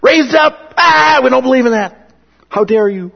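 high, loud indignant outburst; mocking the Sadducees with a loud, high, outraged cry. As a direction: scoff and shout them down in disbelief. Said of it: "the Sadducees are going".

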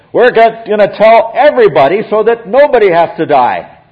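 high and loud, like a public announcement; loud proclamation. Acted. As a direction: proclaim it to the whole crowd. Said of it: "Paul says".

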